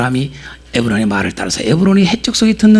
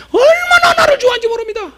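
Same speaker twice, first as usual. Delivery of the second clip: excited cry. Real, not acted. Preacher exclaiming how the seller loves him, excited. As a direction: burst out with joy.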